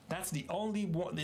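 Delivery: usual speaking voice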